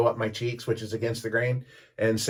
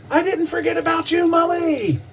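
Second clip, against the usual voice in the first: high, louder stage call to the crowd; imitating the singer; 5 dB louder than usual. Frontman calls out to Molly from the stage, high and loud. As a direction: play the frontman shouting a fan's name.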